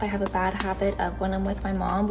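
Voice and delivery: ordinary delivery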